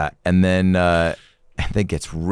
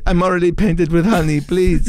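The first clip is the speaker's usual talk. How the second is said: high, louder whiny plea; mock begging kid; 11 semitones higher, 4 dB louder than usual. Painted kid pleading with his captors, high whine.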